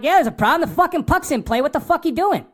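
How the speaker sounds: mock-angry at the fan who stood up; annoyed retort. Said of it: "I'm like".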